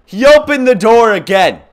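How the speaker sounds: high, loud alarmed cry; startled shout. Real, not acted. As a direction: yelp it in alarm. Baby opens door in horror game.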